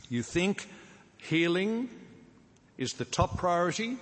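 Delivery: preacher setting up a wrong idea, mock incredulous question. Shown as a demonstration. Mock question he then denies.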